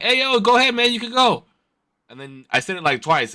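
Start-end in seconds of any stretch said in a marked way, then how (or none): 0.0-1.4 high, friendly call letting him go; polite shout to the driver; friendly wave-on. Retold or imitated. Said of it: "After I'm like".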